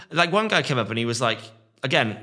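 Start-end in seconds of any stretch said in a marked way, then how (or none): none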